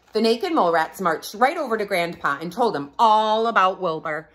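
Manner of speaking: usual speaking voice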